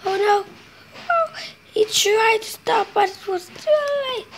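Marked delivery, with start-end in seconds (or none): none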